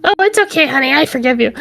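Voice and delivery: high-pitched, sugary mock pardon; mock forgiveness. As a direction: play the all-too-forgiving wife. Mimics too-easy forgiveness, mockingly.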